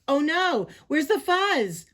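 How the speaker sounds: high, loud cry of dismay; hammed-up alarm. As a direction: panic at a sudden loss. Prairie dogs find fuzz gone.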